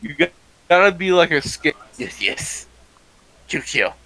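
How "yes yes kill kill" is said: hissing rat puppet voice, whispered; twitchy squeaking rat voice. Shown as a demonstration. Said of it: "if you ever do a rat puppeteer".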